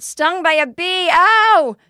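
high, loud and slow, a hammed-up cry of pain; exaggerated yell. Half-naked by her car, yelling to strangers walking by. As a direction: yell it so the passers-by hear an excuse. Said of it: "And I yelled".

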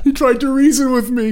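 high voice breaking into laughter; giggling high victim voice; laughing mock victim. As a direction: play the victim while cracking up. Joins the victim bit laughing.

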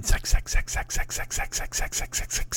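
breathy whispered chant; whispering. Retold or imitated. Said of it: "That's a [name]".

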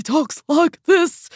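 high, drawn-out character impression; Eddie Redmayne. Film fan showing friends how the actor talks, high slow impression. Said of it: "That's a great impression".

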